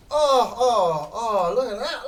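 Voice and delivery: loud, high thrilled exclamation; thrilled by a perfect hit; excited. Real, not acted. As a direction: marvel at the shot. Admiring a great shot.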